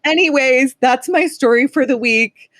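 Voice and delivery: high, teary voice; wrapping up while still crying; through tears. Real, not acted. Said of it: "I'm already crying".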